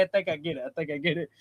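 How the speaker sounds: soft, dropping, resigned agreement; resigned giving in. Retold or imitated. Narrator giving in quietly to his brother's weed lecture. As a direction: let it go quietly to end the lecture. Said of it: "I'm like".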